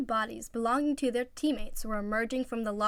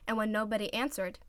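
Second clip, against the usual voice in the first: plain reading voice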